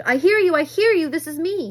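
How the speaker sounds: high, loud desperate reply; boy shouting back to the radio voice; frantic shout. A narrator's reading. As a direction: blurt it out in relief and panic. Boy answers radio in panic.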